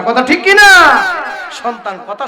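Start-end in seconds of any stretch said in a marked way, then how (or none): 0.0-1.0 loud, high crowd call; rousing shout. Real, not acted. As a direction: shout the question for a response. Demands a response from crowd. (জোর গলায় জনতাকে প্রশ্ন)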